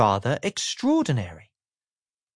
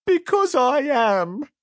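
high, slow, gloomy squeak; sad squeaky voice; 11 semitones higher, 3 dB louder, slower than usual. Acted. Mister Miserable sulks, slow and squeaky. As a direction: drag it out sulkily. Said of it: "a sad, squeaky sort of voice".

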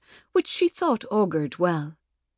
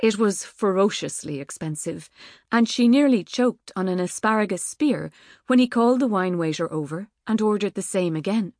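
plain reading voice

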